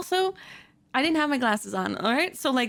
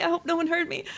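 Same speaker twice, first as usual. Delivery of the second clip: normal talking voice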